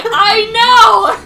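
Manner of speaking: exasperated shout. Retold or imitated. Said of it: "I was like".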